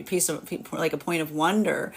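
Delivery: ordinary delivery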